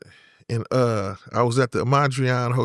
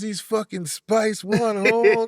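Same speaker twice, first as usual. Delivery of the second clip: higher, slow imitated Chicano announcement; Chicano accent; 8 semitones higher, slower than usual. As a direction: mimic him telling his homies slowly. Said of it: "he says".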